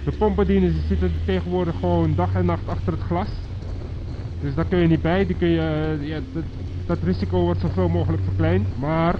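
usual speaking voice

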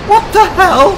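high, loud cry of shock; startled yell. Real, not acted. Gamer reacting to a sudden noise in the game, startled yell. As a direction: shout it out in high-pitched alarm.

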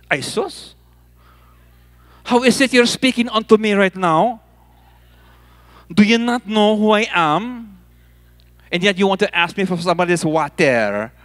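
put-on strong Filipino accent, indignant woman. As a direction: challenge him in a broad Filipino accent. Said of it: "speak in a very strong Filipino accent".

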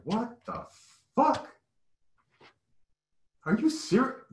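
high, incredulous what-the-hell; stunned disbelief. Retold or imitated. Walking into a stadium full of Confederate flags, high stunned disbelief. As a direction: squeak it out in disbelief. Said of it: "And I was like".

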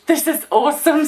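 high, choked-up delight; happy tears. Real, not acted. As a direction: squeeze it out through real happy tears. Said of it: "wasn't gonna cry on camera".

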